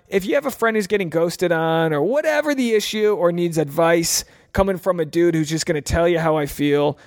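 usual speaking voice

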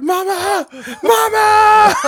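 high, loud wailing for mama; hammed-up grown man wailing; mock crying for mama. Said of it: "cry for your mama".

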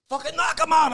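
high, loud egging-on yell; friends yelling. Retold or imitated. Friends yelling at him to finish the fight. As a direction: shout him on to knock the guy out.

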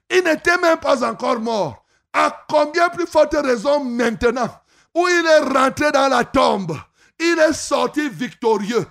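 ordinary talk

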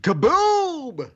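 high, booming comic exclamation; out-of-nowhere explosive shout; random outburst. Acted. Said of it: "says the random shit".

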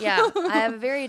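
quick, laughing admission. Real, not acted. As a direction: laugh through the words. Laughs while admitting it.

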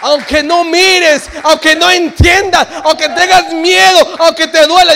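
loud slow impassioned preaching; fervent sermon climax. Acted. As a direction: drive it home with fervor.

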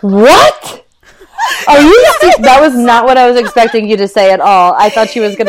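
loud shocked laughing disbelief; shocked shout. Real, not acted. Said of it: "I can't even say it without laughing".

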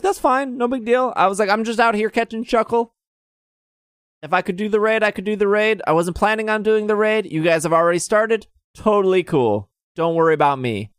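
lightly voiced casual self; imitating own easygoing reply. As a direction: play it totally unbothered. Retelling own chill reply.

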